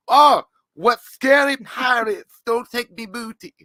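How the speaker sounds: high, loud and slow; hammed-up high pretend fear; mock frightened homeowner. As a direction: squeal in fake fright at the pirates. Creature mimics homeowner to pirates.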